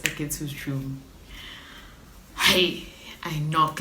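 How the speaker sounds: ordinary delivery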